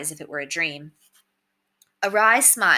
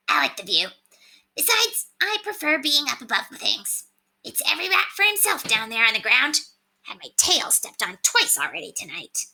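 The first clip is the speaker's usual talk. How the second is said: higher, smug little rat voice; Septimus the rat; 7 semitones higher than usual. A narrator's reading. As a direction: play a cocky talking rat on a shoulder.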